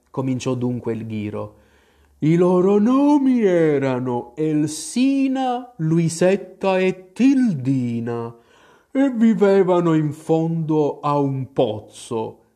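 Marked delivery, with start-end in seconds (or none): 2.2-12.4 slow, higher drawling creature voice; drowsy, dragged-out storytelling; sleepy Dormouse. A narrator's reading. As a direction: tell it as if barely awake. Dormouse tells its tale.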